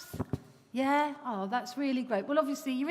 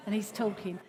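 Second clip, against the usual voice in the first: ordinary talk, nothing marked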